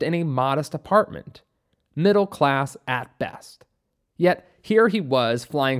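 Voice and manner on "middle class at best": ordinary delivery, nothing marked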